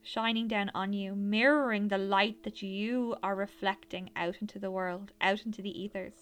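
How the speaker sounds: airy breathy mystical healer. Acted. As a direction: gently guide them inward like a healer. Leads a guided meditation.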